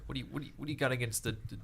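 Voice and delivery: ordinary talk, nothing marked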